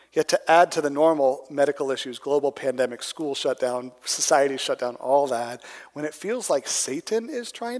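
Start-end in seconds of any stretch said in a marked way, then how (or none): none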